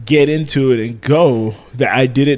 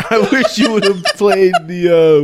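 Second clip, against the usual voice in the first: high giggling laugh; laughing while talking; 5 semitones higher, slower than usual. Real, not acted. Laughing hard.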